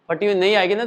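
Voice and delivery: usual speaking voice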